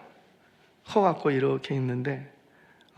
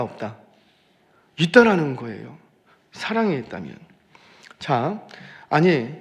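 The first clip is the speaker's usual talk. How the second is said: ordinary talk, nothing marked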